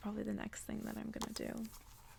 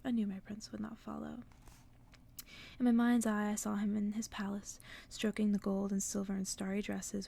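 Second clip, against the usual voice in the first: whispered story reading; 4 dB louder than usual. A narrator's reading. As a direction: breathe the tale out gently, close to the mic. Reads novel aloud, whispery ASMR.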